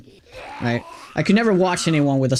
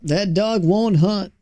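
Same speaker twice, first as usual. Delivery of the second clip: put-on higher, slow Southern accent; 4 semitones higher, slower than usual. Streamer giving chat an American saying in a slow Southern accent. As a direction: drawl it out sweetly, Southern style. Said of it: "That's an actual expression".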